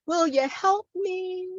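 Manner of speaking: high, sustained poetic plea; performed plea. Acted. Poet pleading for help within the poem, high-voiced. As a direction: lift "help me" high, as a recited appeal.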